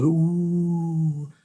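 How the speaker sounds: sustained wondering ooh; crowd ooh. A narrator's reading. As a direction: draw out an awed ooh like a crowd. Crowd reacting to fireworks.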